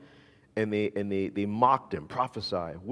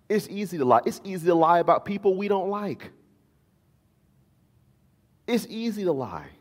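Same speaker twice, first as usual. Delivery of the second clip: higher emphatic preaching; 8 semitones higher, 3 dB louder than usual. Acted. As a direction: press the point home. Emphatic sermon repetition.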